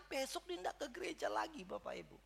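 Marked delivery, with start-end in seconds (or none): none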